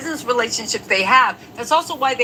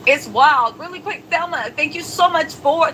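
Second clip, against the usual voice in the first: ordinary talk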